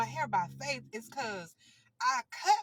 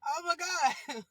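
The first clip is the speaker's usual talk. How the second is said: laughing exclamation; 6 semitones higher, 5 dB louder, slower than usual. Real, not acted. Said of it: "I'm trying not to laugh".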